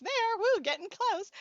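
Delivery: high, loud cheer at almost a hundred; loud happy whoop; excited whoop. Real, not acted. Cheering about her age.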